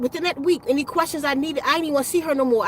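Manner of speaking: normal talking voice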